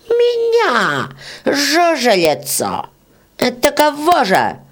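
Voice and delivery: very high indignant squeak; outraged beetle. Acted. Old beetle squeals at the peasant in outrage. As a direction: squeal in offended disbelief.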